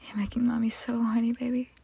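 ordinary talk, nothing marked

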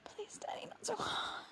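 very soft unvoiced plea; breathless whisper. Whispering a plea to her father mid-punishment. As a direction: barely breathe the words.

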